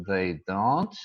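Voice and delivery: each word spaced out and slow for students to copy; slow dictation. Shown as a demonstration. Teacher to students copying the sentence, slow word-by-word dictation. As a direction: spell it out for the class word by word. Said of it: "Então, a gente diz".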